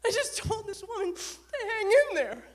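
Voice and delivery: high, loud mock-tearful confession; mortified wail. Rookie confessing to senior partner, mock mortified wail. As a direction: wail it like a hammed-up sob.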